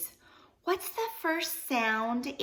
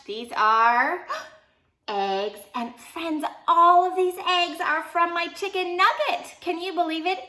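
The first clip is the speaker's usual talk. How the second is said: excited reveal; 6 semitones higher, 4 dB louder than usual. Pretend. Teacher reveals eggs excitedly.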